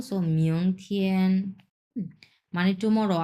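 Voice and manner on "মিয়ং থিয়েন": "মিয়ং থিয়েন" slowly, "থিয়েন" held level; slow mi-ong thien with a sustained first tone; tone demo. Shown as a demonstration. Teacher explaining tone one, slow "মিয়ং থিয়েন" with level "থিয়েন". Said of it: "থিয়েনটা এক number tone".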